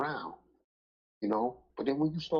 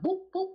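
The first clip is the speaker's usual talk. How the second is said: imitated hits; 4 dB louder than usual. Mimics dog hitting another twice.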